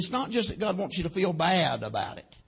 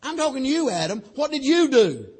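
high, louder accusing question at the end; God pressing Adam, insistent and raised; pointed confrontation; 8 semitones higher, 4 dB louder than usual. Acted. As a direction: confront him directly, singling him out.

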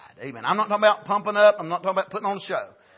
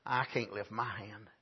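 softer, slow, low aside; timid inner thought; 6 semitones lower, 6 dB quieter, slower than usual. Acted. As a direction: think it quietly to yourself. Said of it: "they thought".

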